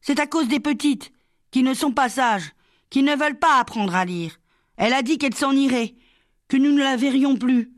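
put-on high, plaintive child voice; acted distressed small child; upset little girl. A narrator's reading. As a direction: explain through childish upset, pitched well up. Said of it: "Lali éclata en sanglots".

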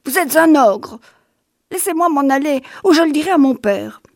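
put-on high, pleading child voice, insults thrown at a monster; a boy terrified and defiant; scared little boy hurling insults; frightened child.

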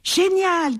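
high, delighted exclamation; excited cry. A narrator's reading. Bat turns towards the sun, crying out excitedly. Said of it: "crie Bat".

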